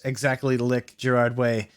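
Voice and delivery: usual speaking voice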